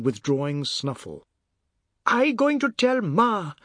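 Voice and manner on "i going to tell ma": high, slow wailing threat; bawling cry. A narrator's reading. Boy covered in food threatening to tell, bawling cry. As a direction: bawl it out like a tattletale. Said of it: "the god cried".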